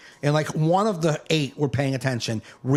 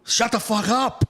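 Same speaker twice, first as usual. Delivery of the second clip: high, exasperated mock outburst; exasperated, high-pitched reenactment; retold telling-off; 10 semitones higher, slower than usual. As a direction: tell a rowdy table off, pitched way up. Retold telling-off of noisy table.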